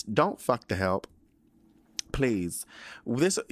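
ordinary talk, nothing marked

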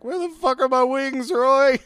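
imitating Jamie yelling for his wings; mock yell. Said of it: "the way he yells".

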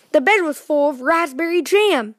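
plain reading voice

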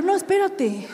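high laughing stop; amused high no, wait. Retold or imitated. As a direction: laugh out the correction. Laughing realization.

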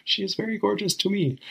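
put-on high squeaky kid voice, pushed hard; meme kid impression. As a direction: squeak it like a bullied kid standing up for himself. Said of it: "he just, like, stands up for himself".

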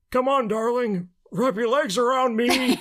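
put-on high, slow, forced old man. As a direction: strain it out like a young person faking an old man. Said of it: "the most forced old man voice".

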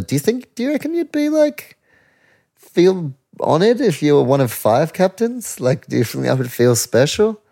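high, put-on reporter voice asking the question; hammed-up high interview voice; mock interviewer. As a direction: pitch way up like a cheesy TV interviewer.

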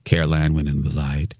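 mock a mild-mannered ghost hunter; understated low droning presenter; imitating a calm presenter. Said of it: "this is one of Dawn's co".

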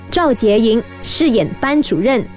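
ordinary talk

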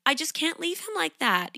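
high, whiny voicing of her guilt; guilty inner voice. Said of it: "If you are thinking anything like".

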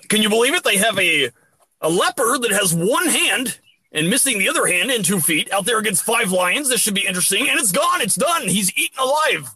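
mock commentator. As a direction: call the lion fight like live sport.

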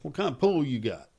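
Texas caller's twang; lightly put-on Texas voice; Texas accent. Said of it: "I'm doing a Texas accent".

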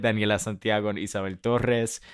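names said with a light Spanish accent; Spanish pronunciation. Shown as a demonstration. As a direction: give the names their Spanish sounds. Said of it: "Ooh, I like that accent".

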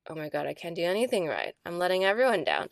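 fast, panicky self-blame; rushed guilty inner voice; spiraling self-blame. Retold or imitated. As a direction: rattle it off in a spiral. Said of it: "I was just like".

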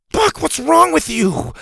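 very high-pitched scolding; exasperated kid telling off a pet. A narrator's reading. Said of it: "Bo scolded".

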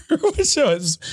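high-pitched, laughing through the words. Real, not acted. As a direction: break into a real laugh as you speak. Laughs at own guest mishap.

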